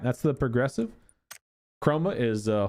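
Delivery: normal talking voice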